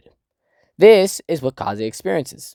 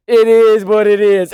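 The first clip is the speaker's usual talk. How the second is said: shrug; 8 semitones higher, 6 dB louder than usual. Retold or imitated. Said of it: "Kaze just goes".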